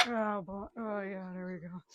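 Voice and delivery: ordinary talk, nothing marked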